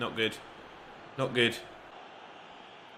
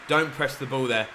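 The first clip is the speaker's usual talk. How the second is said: usual speaking voice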